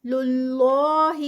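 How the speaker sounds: للہ loud and slow with a heavy, stretched لا; heavy Allah. Shown as a demonstration. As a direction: give للہ a full heavy two-count stretch. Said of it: "heavy پروناؤنس ہوگا".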